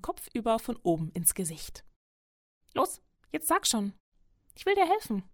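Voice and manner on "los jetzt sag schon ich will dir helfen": high, coaxing small animal voice; high-pitched hare, keen to help; eager little hare. A narrator's reading. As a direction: pipe up and urge them on. Hare urges the wolf to talk.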